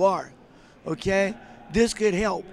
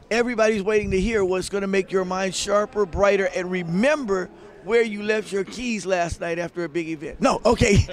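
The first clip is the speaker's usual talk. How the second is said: ordinary talk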